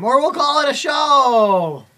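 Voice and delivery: high, slow, loud sing-song; loud exclamation. Real, not acted. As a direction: belt it out like a host.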